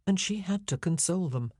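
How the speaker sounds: ordinary delivery, nothing marked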